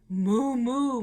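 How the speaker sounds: ordinary delivery, nothing marked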